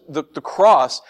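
ordinary talk

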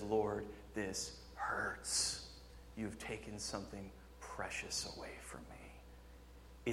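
very quiet, slow and low, nearly whispered; pained cry to God. Acted. Preacher voicing David's hurt to the Lord, hushed anguish. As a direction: let the hurt sink into a hushed, halting plea. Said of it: "just like David cries out here".

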